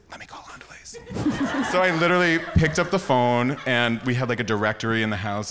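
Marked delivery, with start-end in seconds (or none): 0.1-0.8 whisper. Retold or imitated. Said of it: "I was like".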